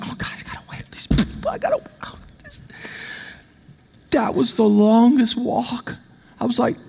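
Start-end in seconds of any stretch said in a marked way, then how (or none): 0.0-2.6 hushed, hurried whispered grumble; dreading whisper. Retold or imitated. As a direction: whisper a dreading complaint to yourself. Dreading the table, muttered complaint.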